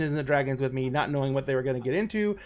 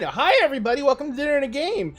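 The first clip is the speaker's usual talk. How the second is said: high cracking host greeting; cracking show intro; 8 semitones higher, 3 dB louder, faster than usual. Real, not acted. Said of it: "my voice is cracking like a thirteen-year-old".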